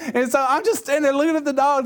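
normal talking voice

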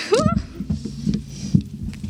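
high, breathy laugh; high teary laugh. Real, not acted. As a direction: laugh it out with relief. Emotional laugh.

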